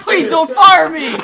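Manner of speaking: loud, high, drawn-out laughing plea. Retold or imitated. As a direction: stretch the plea out the second time.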